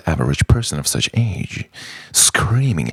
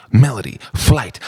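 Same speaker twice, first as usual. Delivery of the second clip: usual speaking voice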